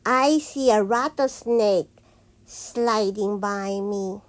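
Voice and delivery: usual speaking voice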